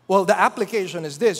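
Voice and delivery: ordinary talk, nothing marked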